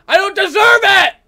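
high, loud protest; aggrieved shout. Real, not acted. Gamer harassed while cleaning up, aggrieved shout. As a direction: shout it as the wronged party.

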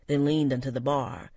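plain reading voice

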